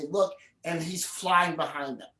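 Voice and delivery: usual speaking voice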